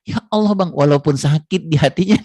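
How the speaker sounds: high, loud, swooning wife; wife moved that her husband asks about her; touched wife. Retold or imitated. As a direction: melt at his concern. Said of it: "dia bilang gitu".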